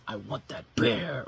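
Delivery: lightly voiced slow gruff old actor; a hint of a growled slow demand; gruff Charlton Heston. Reviewer mocking an actor's gruff line in an old film, slow light imitation. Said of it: "is using a gruff accent and basically going".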